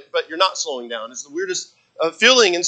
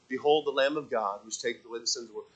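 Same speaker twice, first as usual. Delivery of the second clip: flat, quiet, no passion in "Behold the Lamb of God"; "Behold" and "God" mumbled softly, no exclamation; 6 semitones lower, 11 dB quieter than usual. Shown as a demonstration. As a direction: say it dull and low, like a dry reading. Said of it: "He didn't say".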